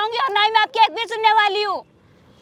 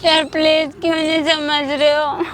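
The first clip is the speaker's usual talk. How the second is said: slow, lower-pitched plea; worn-down, dragging appeal; weary pleading; 4 semitones lower, slower than usual. Acted. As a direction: beg him wearily, drawing the words out. Woman pleads to be let go.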